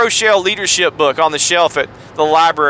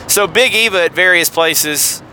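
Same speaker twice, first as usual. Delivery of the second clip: ordinary talk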